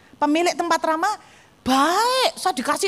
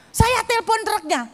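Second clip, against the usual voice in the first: usual speaking voice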